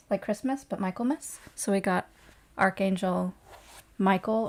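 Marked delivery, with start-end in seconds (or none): 4.0-4.5 "Michael" said the everyday English way, MY-kul; English pronunciation. Shown as a demonstration. Host naming the archangel, "Michael" in plain English MY-kul. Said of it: "Michael or Michael or Michael".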